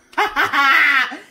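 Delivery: loud burst of laughter. Real, not acted. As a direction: crack up at the joke. Laughing at own joke.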